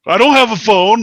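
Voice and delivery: put-on high, loud whiny kid; sulky kid complaint. Bored son to dad, high whiny complaint. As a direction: play the bored son pointing at dad's phone. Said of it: "He's like".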